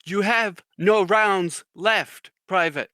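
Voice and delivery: slow, loud, high mock reprimand; drill sergeant. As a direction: spell it out for a hopeless recruit. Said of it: "he's like".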